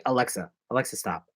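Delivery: usual speaking voice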